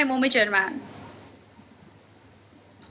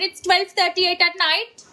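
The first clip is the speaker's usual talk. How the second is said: ordinary delivery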